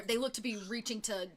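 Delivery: normal talking voice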